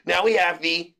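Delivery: ordinary talk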